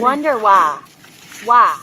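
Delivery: loud, mocking wonder why; loud sarcastic why. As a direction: call out the lie loudly. Sarcastic repeat at mother's claim.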